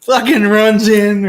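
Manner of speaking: very high, loud squeal of delight; overjoyed. Retold or imitated. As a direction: squeal with giddy joy. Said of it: "I was like".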